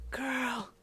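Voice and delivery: high, drawn-out flamboyant amazement; awestruck, flamboyant. A narrator's reading. Boyfriend hearing of a meeting with Beyonce, awestruck. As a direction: stretch it out, soaring up in awe. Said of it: "he said in awestruck, flamboyant wonder".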